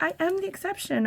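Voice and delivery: very high, proud declaration; hammed-up smug lady; mocking the lady. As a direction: squeak it out like she's proud of herself. Lady claiming exception.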